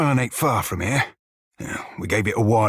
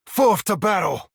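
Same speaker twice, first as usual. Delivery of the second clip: charging shout; 7 semitones higher than usual. Acted. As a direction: send the crew into the fight. Battle cry, higher take.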